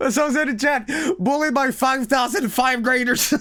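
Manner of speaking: high, squeaky with suppressed laughter; real laughing fit. Real, not acted. Streamer reading a chat message aloud, cracking up. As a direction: read it out while falling apart laughing.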